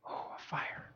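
whispered, breath-like delight; hushed relief. Retold or imitated. Peter spotting a fire on a cold night, whispering. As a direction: act out a soft whisper on finding warmth.

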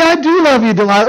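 high start, dropping on the name; mock protest. Samson defends himself to Delilah, mock protest. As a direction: protest your love, hammed up.

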